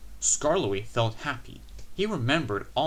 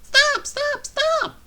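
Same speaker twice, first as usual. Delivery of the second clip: high, loud wailing cry; wailing coaches; 8 dB louder, much slower than usual. A narrator's reading. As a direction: wail it in a shrill panic. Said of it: "wailed the coaches".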